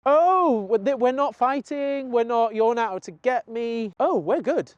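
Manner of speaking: high, lilting voice of dawning relief; realizing dog. Acted. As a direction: act a dog relieved to find no threat. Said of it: "the dog goes into a situation and goes".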